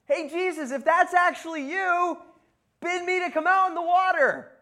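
high, loud holler across water; calling out. Acted. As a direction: shout eagerly to someone far off. Peter calls across the water.

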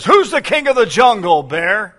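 loud, high lion roaring his question; proud lion's challenge. Acted. Preacher voicing the proud lion to the bear, loud and high. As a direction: boom it out like a proud king of beasts. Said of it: "He looks at the bear and says".

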